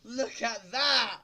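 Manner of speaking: loud laughing cheer; triumphant shout. Real, not acted. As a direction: cheer like you just won.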